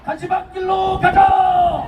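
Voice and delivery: slow, shouted rally chant; roaring rallying speech. Acted. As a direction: drag each word out for the crowd.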